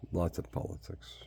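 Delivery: "Lots of politics" breathed out in a whisper, no voice; whispered aside. Shown as a demonstration. Muttering to the listener about the politics, whispered aside. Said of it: "lots of politics behind".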